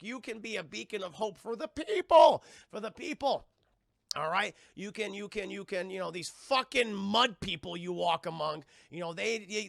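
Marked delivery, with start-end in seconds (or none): 0.0-5.9 high voice swelling louder to a climax; mock rousing oratory. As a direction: build to a hammed-up heroic appeal. Acts father's rousing speech.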